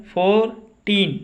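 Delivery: Fourteen broken as फोर, pause, टीन; teacher counting out the two parts of fourteen; split into syllables. Shown as a demonstration. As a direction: give fourteen two separate, drawn-out beats. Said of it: "दो बार उच्चारण हुआ".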